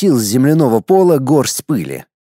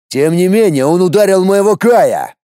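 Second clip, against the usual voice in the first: an explosive, louder yell; furious shout; 5 semitones higher, 4 dB louder than usual. Acted. The other warrior bursts out at the mother, furious. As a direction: burst out in rage. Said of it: "Взорвался яростным криком".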